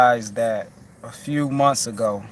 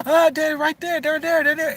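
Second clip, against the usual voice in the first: imitating a child's very high, loud alarmed cry; mock kid pointing something out in fright; alarmed son; 4 dB louder than usual. Son alerts dad to something there, high frightened child cry. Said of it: "And he was like".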